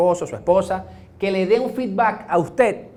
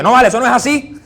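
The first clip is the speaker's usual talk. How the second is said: raised, louder indignant protest; a retold flare of temper, pushed up and loud; angry retort; 6 semitones higher, 7 dB louder, faster than usual. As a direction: snap back in mock anger. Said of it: "si yo me pongo bravo y dice".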